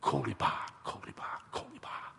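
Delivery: very soft, breath-only secret word; hushed word passed ear to ear; narrated whisper. A narrator's reading. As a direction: whisper it repeatedly, fading away. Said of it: "whispers in their ear".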